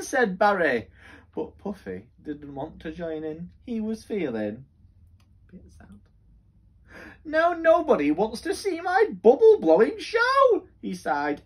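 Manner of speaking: lively, sing-song storybook voice; reading to children. Acted. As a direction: read it like bedtime story time for kids.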